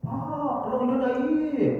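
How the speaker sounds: slow, high drawn-out call; hollering for someone upstairs. Retold or imitated. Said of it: "Tak boleh berteriak-teriak". (memanggil panjang dengan nada tinggi)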